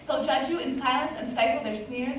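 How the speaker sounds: usual speaking voice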